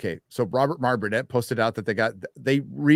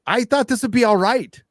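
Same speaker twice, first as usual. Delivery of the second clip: hopeful; 11 semitones higher, 7 dB louder than usual. Retold or imitated. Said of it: "I'm like".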